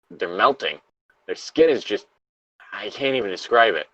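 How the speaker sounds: a lightly acted trapped survivor, reading steadily; reading a Fallout lore log aloud to a friend, somber. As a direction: read the survivor's diary entry gravely. Reads the log aloud in turn.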